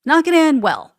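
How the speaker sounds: deadpan the inevitable; sarcastic warning. Real, not acted. Host predicting a bad end for Lindell's lenders.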